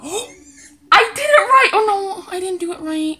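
high, loud, laughing then oh no; excited then dismayed. Real, not acted. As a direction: burst of joy turning to panic.

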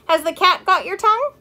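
put-on high-pitched teasing of a child; cooing neighbor. A narrator's reading. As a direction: prod a shy child in a singsong coo. Neighbor teases silent child.

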